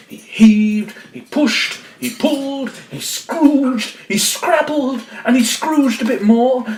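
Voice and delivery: high, effortful list building up; straining narration. A narrator's reading. Narrator telling how the stuffed wolf struggles at the door, straining. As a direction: squeeze each verb out as if shoving.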